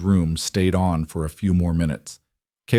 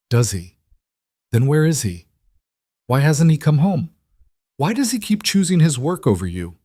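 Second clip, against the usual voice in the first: higher, rising, cruel questions; mocking taunt; 7 semitones higher than usual. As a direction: needle the child with each question. Marissa mocks the child.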